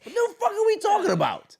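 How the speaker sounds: loud, high-pitched disbelief; incredulous outburst. Acted. Host breaks the hushed bit for the co-host, incredulous outburst. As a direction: burst out, pitch leaping high over laughter.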